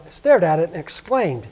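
plain reading voice

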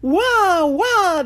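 two long, high, loud wails; woodman's double shriek of fright; terrified yell. A narrator's reading. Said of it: "the big woodman yelled".

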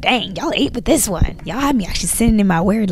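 ordinary talk, nothing marked

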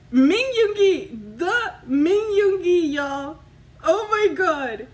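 loud, high, stretched-out disbelief; ecstatic. Real, not acted. Fan revealing her [name] film strip, ecstatic cry. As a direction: belt out the name in awe.

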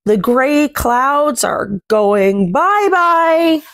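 normal talking voice

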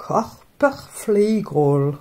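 slow German-accented Körperpflegerol. Shown as a demonstration. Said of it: "avec l'accent". (Körperpflegerol lentement, avec l'accent allemand)

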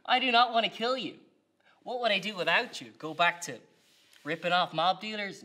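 loud, high, gleeful disbelief; manic delight. Acted. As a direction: laugh it off like a madman. Villain mocks the interrogator.

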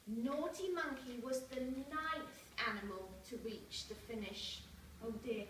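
ordinary delivery, nothing marked

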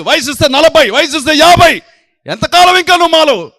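fiery shouted rebuke; shouting preacher. Acted. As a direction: scold the congregation at the top of your voice.

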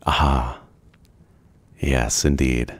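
ordinary delivery

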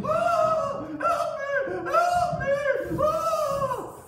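very high, loud staged shriek; screaming for help. Acted. Wife screams for the king's guards, frantic. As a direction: shriek frantically, stretching each cry.

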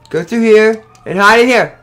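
ordinary delivery

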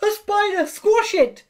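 very high, loud alarm; piercing cry at a creepy-crawly; screamed. A narrator's reading. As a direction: shriek it out in panic. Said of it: "screamed someone".

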